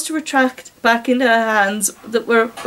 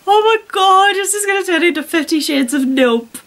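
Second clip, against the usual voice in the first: exasperated; 6 semitones higher than usual. Real, not acted. Reader reacts to the fanfic.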